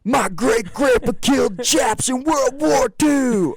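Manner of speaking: high, loud stage shout. Retold or imitated. Rocker shouting to the fair crowd, mock stage yell. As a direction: holler it slowly to a whole arena. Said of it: "Ted comes out".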